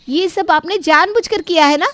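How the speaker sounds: high, loud challenge; angry accusation. Acted. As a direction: confront the sister-in-law. Radha accuses her sister-in-law. (गुस्से में आरोप लगाते हुए)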